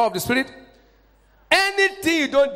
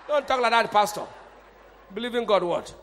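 normal talking voice

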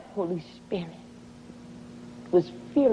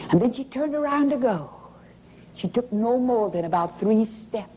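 ordinary delivery

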